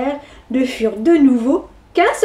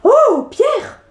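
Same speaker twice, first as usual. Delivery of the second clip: indignant exclamation; 9 semitones higher than usual. Acted. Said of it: "s'exclama ... indignée".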